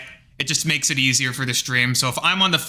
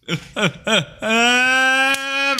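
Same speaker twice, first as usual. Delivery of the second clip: high silly cackle on cue; goofy laugh; 9 semitones higher than usual. Shown as a demonstration. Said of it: "Goofy, ah, laugh".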